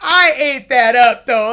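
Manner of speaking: loud, slow, proud brag; loud slow boast about doing well. Real, not acted. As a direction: really own the moment, loud and drawn out. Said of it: "be really that proud of myself".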